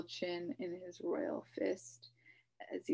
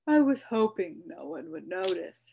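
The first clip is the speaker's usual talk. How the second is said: sadly; 7 dB louder than usual. A narrator's reading. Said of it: "the king said sadly".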